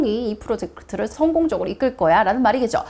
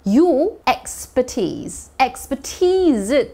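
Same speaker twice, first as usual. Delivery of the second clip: expertise stretched slowly with the stress on the last syllable TISE; slow model of expertise, stress on TISE; much slower than usual. Shown as a demonstration. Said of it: "T의 강세 있죠?".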